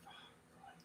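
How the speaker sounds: a resigned, barely audible fuck; defeated whisper. Retold or imitated. Boy hearing his father yell for him, whispering in defeat. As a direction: breathe the curse out with no voice. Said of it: "it's like".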